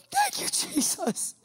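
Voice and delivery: high, slow breathy thanks; slow high-pitched thank-you to Jesus; grateful prayer. Retold or imitated. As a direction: pray it out in relief. Thanking God at the gate.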